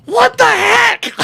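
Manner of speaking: a shocked wife yelling down the phone; wife's shout. Retold or imitated. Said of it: "my wife was like".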